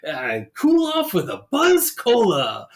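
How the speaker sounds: high, drawn-out mock advert sell; commercial pitch. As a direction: sell it like a hammy TV ad. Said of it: "it's a commercial".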